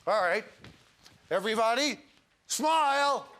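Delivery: high, loud stagey call to a crowd. Pretend. Host hamming it up for the audience, high stagey call.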